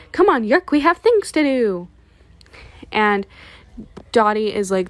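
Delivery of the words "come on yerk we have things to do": higher and louder, calling to Yerk as she leaves; imitating a brisk commander barking an order; bossy exit. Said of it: "she leaves and is like".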